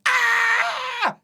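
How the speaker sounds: high, strained cry of exasperation; high frustrated shout as she still doesn't understand; frustrated scream. Retold or imitated. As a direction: let out a despairing yell. Frustrated at not being understood.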